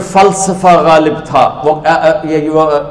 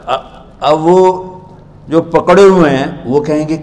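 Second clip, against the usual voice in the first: ordinary talk, nothing marked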